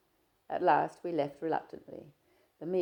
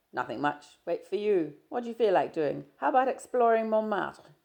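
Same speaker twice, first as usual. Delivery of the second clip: a louder, lightly voiced young man, breezy; Stephen, teasing; 5 semitones higher, 10 dB louder than usual. Stephen greeting his shy friend, easy and teasing. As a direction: play Stephen chatting easily, a touch louder.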